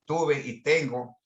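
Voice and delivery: normal talking voice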